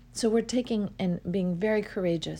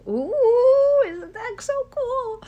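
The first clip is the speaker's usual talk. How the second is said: excited squeal; 9 dB louder, much slower than usual. Real, not acted. Thrilled by workbook idea.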